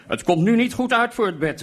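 loud, high shout; calling out. A narrator's reading. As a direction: holler it for everyone to hear. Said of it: "riep ik".

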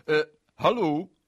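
loud, slow, high hello; startled answering the phone; confused hello; flustered phone greeting. Acted.

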